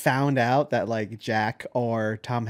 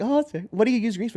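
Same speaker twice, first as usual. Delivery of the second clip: high surprised voice; 8 semitones higher than usual. Retold or imitated. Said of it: "He's like".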